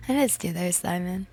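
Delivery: normal talking voice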